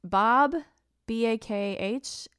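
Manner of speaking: ordinary talk, nothing marked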